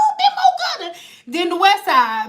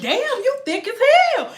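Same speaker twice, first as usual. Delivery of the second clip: higher, hammed-up street catcall; imitating a guy's pushy come-on; 6 semitones higher than usual. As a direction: holler after a girl walking past. Dude catcalling a passing woman.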